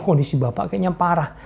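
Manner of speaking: normal talking voice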